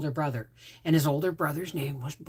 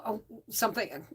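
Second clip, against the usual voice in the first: ordinary talk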